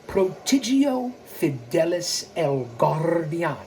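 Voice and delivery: slow, deliberate Spanish flourish, rolling the R; Spanish accent. Shown as a demonstration. Host repeating his heritage account name, slow Spanish accent. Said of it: "Let me say it again".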